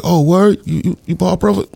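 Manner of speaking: high-pitched, stammering surprise; surprised recognition. Retold or imitated. Man learning whose brother he's talking to, high and surprised. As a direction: act out someone suddenly impressed, voice jumping up. Retelling Dame's surprised reaction.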